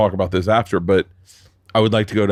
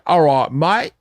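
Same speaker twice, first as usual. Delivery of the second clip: put-on chirpy Cockney-ish British accent, pitch jumping up; a quick send-up of a British accent; mock British accent; 7 semitones higher, slower than usual. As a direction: toss it off like a cheeky Londoner in a British accent. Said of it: "they hear me talk and they're like".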